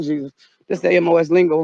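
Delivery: ordinary delivery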